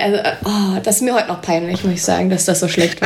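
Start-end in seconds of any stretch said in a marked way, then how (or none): none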